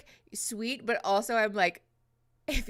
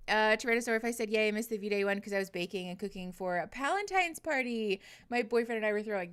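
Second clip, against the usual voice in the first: ordinary talk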